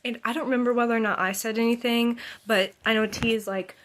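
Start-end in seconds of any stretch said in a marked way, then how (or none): none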